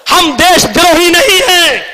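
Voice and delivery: high, forceful declaration of loyalty; defiant cry. Real, not acted. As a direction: cry out the denial to the crowd. Defiant denial, repeated less forcefully.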